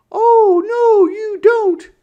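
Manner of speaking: put-on very high, loud girl's shout; shouted back. A narrator's reading. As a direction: yell it back in defiance. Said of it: "Sister shouted back".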